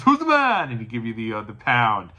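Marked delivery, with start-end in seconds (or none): 0.0-0.7 much higher, louder hyped-up cry to the crowd; hype call. Retold or imitated. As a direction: pump up the fans like a wrestler. Wrestler hyping up fans.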